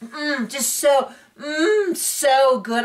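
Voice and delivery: high, drawn-out mock yum; mock moaning foodie. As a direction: imitate Kenya hamming up the taste. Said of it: "Kenya's like".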